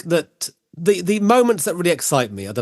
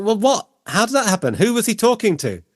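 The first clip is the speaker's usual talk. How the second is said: high-pitched, incredulous questions, climbing at the end; astonished, mock-bewildered outburst; baffled disbelief; 9 semitones higher than usual. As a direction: act out being completely flummoxed, building to a higher repeat. Acting out baffled reaction to story.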